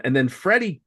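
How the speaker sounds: usual speaking voice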